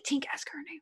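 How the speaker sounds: hushed aside to a friend; covert whisper. Acted. Asking a friend to get the forgotten name, whispered. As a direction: whisper it so she won't hear.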